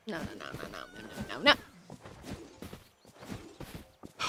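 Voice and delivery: very low, groaning string of no; deep moaning no. Acted. Fan groaning at the idea of a racing-only Cars, very low moaning. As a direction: sink into a deep dramatic groan. Mock despair, voice dropping.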